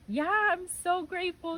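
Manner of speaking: high, loud outpouring of thanks; grateful praise. Retold or imitated. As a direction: imitate an overjoyed praying mother. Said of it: "my mom was just praising the Lord".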